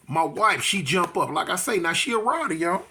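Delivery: ordinary talk, nothing marked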